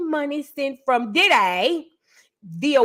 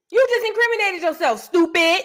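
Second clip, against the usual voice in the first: higher scolding taunt; mocking scold; 7 semitones higher, 3 dB louder than usual. Real, not acted. Host scolding the singer for her confession, mocking. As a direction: throw it at her like a teacher.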